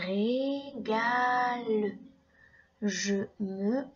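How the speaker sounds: "Régale" very slowly, each syllable separated. Shown as a demonstration. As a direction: drag "Régale" out, ré-ga-le. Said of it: "Écoute bien chaque syllabe".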